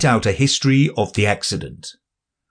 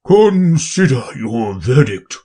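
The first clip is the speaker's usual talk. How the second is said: low trembling voice; 3 semitones higher, slower than usual. Acted. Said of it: "in a low, trembling voice".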